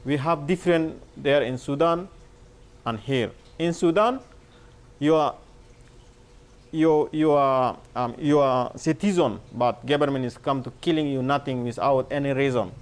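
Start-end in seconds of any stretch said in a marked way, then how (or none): none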